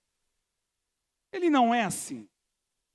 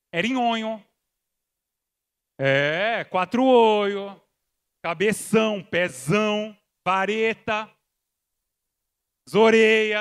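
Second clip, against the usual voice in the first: nicknames shouted out; 2 semitones lower, 8 dB louder, slower than usual. Real, not acted. Listing schoolyard nicknames, increasingly loud.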